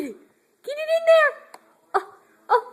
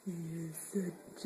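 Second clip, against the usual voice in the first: whisper; 12 semitones lower, 19 dB quieter than usual. Real, not acted. Whispered praise to baby.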